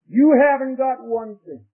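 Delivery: high, loud, slow retold telling-off; forceful rebuke. Camp speaker rebuking a wisecracking boy, forceful rebuke.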